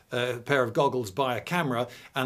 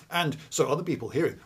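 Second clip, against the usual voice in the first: normal talking voice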